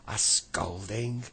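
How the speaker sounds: loud, slow angry roar. Acted. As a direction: roar it out in outrage. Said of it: "roared the angry pixie".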